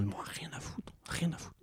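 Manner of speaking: a muttered don't-care whisper; whispered aside. Real, not acted. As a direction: drop to a whisper, dismissive. Chuchote son désintérêt. (chuchoté, je-m'en-foutiste)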